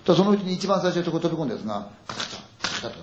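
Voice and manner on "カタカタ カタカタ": rattling noise. Retold or imitated. 戸を揺する物音の擬音.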